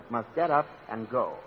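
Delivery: ordinary delivery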